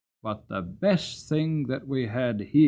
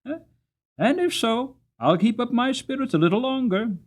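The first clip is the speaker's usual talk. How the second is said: Jerry voiced much higher and louder, good-natured; pleasant Jerry; 9 semitones higher, 5 dB louder than usual. Jerry answering the cabman's warning, pleasantly and high. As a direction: shrug it off cheerfully, teasing a little. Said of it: "Jerry pleasantly".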